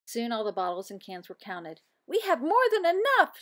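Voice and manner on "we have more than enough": high, loud excited shout; a thrilled shout to the class. Acted. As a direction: shout it out in joy. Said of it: "shouted Nora".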